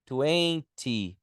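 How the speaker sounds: "Twenty" with crisp T, stress on first syllable; twenty pronounced. Shown as a demonstration. Teacher demos "Twenty" against twelve, clear and crisp. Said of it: "Eu vou deixar até gravadinho aqui".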